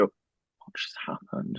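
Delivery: hushed whispered question. Retold or imitated. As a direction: whisper it like coworkers sneaking over. Coworkers whisper after firing.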